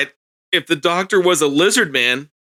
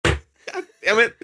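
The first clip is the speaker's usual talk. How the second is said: stifled giggles; 8 semitones higher, slower than usual. Real, not acted. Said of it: "Need to get the giggles out?".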